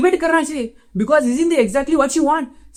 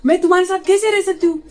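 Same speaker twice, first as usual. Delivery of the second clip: higher-pitched mock girlfriend voice; complaining girlfriend; 4 semitones higher than usual. Man mimics girlfriend rejecting Batman, in mock complaint. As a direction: imitate her reproach, pitched up. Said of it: "उस दिन बोली".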